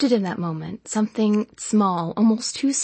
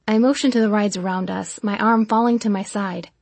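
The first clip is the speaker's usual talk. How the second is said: usual speaking voice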